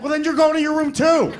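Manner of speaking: high, loud annoyed father; father scolding his son; exasperated dad. Retold or imitated. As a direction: punish him too. Dad punishing second son.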